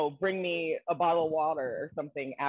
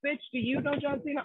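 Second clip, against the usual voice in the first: sassy retort; 12 semitones higher than usual. Retold or imitated. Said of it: "Oh, I'm sorry".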